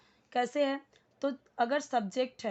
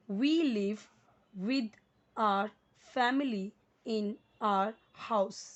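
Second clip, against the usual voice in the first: word by word, very slow read-aloud; slow reading demo; much slower than usual. Shown as a demonstration. Teacher reading a first-standard book aloud to learners, very slow. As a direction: read each word out separately for beginners. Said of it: "इसी तरीके से आपको भी read करना है".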